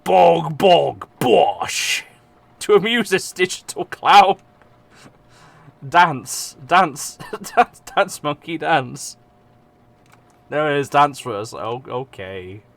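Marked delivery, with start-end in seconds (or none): 0.0-2.0 silly. Pretend. Silly exclamation. 2.6-11.5 mock taunt. Mocks himself as dancing clown.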